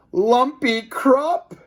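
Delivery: high, slow moan. A narrator's reading. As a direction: moan the name out in misery. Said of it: "moaned Mister Krupp".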